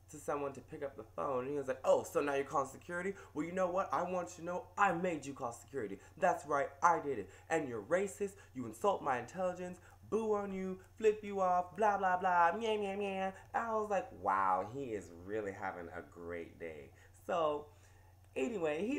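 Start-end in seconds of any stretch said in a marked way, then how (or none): 1.8-13.3 louder, higher mock rant of a yelling customer; hammed-up furious customer ranting. 14.2-16.9 sarcastic. Retold or imitated. Sarcastic aside to himself.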